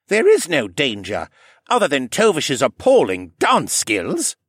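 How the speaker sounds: gruff Horace. Acted. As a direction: Horace reassuring, dry. Horace speaking in the library.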